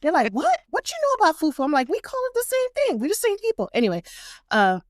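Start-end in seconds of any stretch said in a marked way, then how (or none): 0.3-1.6 high-pitched incredulous challenge; mock surprise. African friends challenge her over fufu, mock surprise. 1.9-3.7 high-pitched insistent comeback; animated retort. Retold or imitated. Grad student answers her African friends, animated retort.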